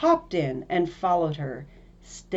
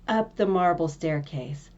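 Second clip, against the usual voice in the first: usual speaking voice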